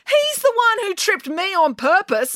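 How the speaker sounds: high-pitched, indignant accusation; protesting schoolgirl pointing the blame; accusing. Acted. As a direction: throw the blame across. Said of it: "accused April".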